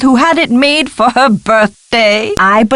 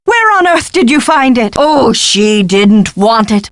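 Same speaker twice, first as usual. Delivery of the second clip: ordinary talk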